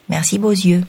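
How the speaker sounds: plain reading voice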